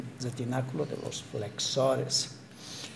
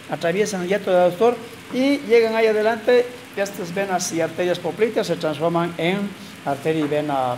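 louder, projecting the anatomy names to the room; loud lecturing; 3 semitones higher, 8 dB louder than usual. Real, not acted. Lecturer explaining leg veins to students, loud and projected. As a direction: push the voice out to reach the back rows. Lecturer raises voice over class.

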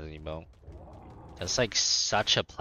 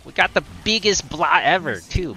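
excited boast; 10 semitones higher, 9 dB louder than usual. Real, not acted. Excited about huge roar.

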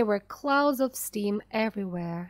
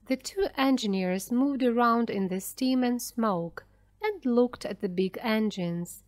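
usual speaking voice

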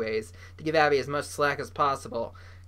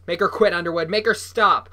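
loud, raised demanding yell; shouted demand; 3 semitones higher, 7 dB louder than usual. A narrator's reading. Bully throttles Noah and demands help, shouting. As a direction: yell the order, building on the last words.

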